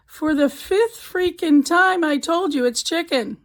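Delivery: slow, loud, fed-up outburst; exasperated wife. Acted. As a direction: spell it out like you've said it five times already. Fed-up wife snaps back.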